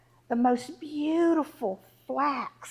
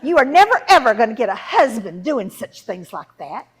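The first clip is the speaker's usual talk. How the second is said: very loud, indignant warning; scolding mother; 12 dB louder than usual. Acted. Mother warning daughter she will never marry, loud scolding. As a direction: lay down the law at full volume.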